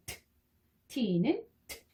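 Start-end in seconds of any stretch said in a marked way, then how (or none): 0.1-0.1 T as a crisp tongue-tip puff; voiceless T sound. Shown as a demonstration. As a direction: release T with air only, no vowel. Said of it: "T가 내는 소리는".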